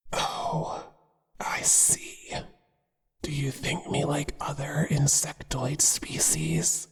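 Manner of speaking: ordinary delivery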